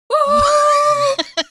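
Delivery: long high "ooh" held out; high, sustained comic hoot; mock hooting cry. Prisoner walking out of the place, long high mock hoot. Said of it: "just goes".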